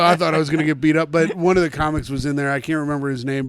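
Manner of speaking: normal talking voice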